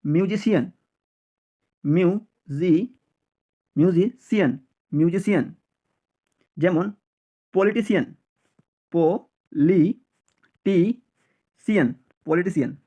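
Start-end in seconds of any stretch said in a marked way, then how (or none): 1.8-4.6 syllable by syllable. Shown as a demonstration. Teacher builds word in pieces. 8.9-11.9 পো-লি-টি-সিয়ান slow, a pause after each piece; syllable by syllable. Shown as a demonstration. Teacher drilling learners, পো-লি-টি-সিয়ান in slow separate syllables.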